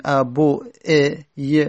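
ابو ا ی spelled out slowly sound by sound; slow, halting ابو ا ی showing the clash; slow demo. Shown as a demonstration. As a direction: break ابو ا ی into its pieces, slowly. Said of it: "نمیتونیم همزمان به دمه و کسره تلفظ بزنیم".

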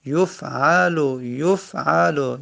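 یفعلو slow, as yuf-'aa-lu; damma then fatha. Shown as a demonstration. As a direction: stretch the middle "a" of یفعلو after an initial "yu". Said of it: "یا اول دمه".

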